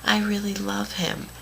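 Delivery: high mocking girl voice; put-on high voice of a pleading friend; imitated pleading friend. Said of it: "no, and she said".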